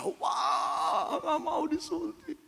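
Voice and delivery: put-on high child's wail, pulled back; small boy crying he won't be jabbed; child refusing. Sick boy protests the injection in a high child wail.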